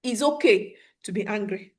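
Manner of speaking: usual speaking voice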